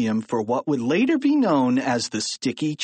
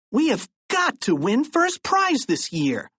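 high, eager schoolboy; excited exclamation; 10 semitones higher than usual. A narrator's reading. Harold urging George to win the contest, excited exclamation. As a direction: exclaim it with high-pitched determination. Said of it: "exclaimed Harold".

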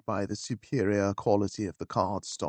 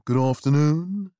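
intoned; 3 semitones higher, 4 dB louder than usual. A narrator's reading. Butler greeting a visitor at the door, intoning. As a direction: intone it like an old-school butler. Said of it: "he intoned".

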